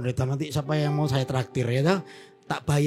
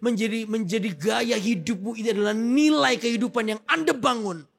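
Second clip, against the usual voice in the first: raised, ringing sermon voice, pitched high; full-force preaching; 6 semitones higher than usual. Acted. Pastor exhorting the congregation to be generous, preaching at full force. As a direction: preach it out to the hall with fervour.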